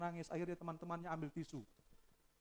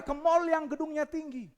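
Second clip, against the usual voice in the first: high, loud preaching reply; loud answer; 13 semitones higher, 13 dB louder than usual. Acted. Preacher answering his own question, loud. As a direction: snap back the answer.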